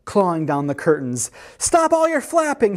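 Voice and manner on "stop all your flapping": high indignant cry; yelled. A narrator's reading. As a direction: yell it high without full volume. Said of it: "he yelled as he leapt".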